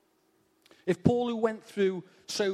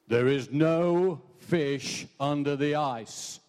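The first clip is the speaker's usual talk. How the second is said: slow, booming voice from nowhere; mysterious voice; 3 semitones lower, 3 dB louder, much slower than usual. Acted. Joke teller voicing the hidden voice, slow and eerie. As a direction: intone it slowly like an unseen presence. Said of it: "he hears this voice saying".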